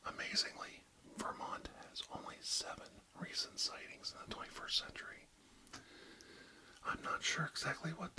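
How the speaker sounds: ASMR whisper, close to the mic; barely audible, intimate whisper. Acted. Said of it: "real close to inaudible whispers today".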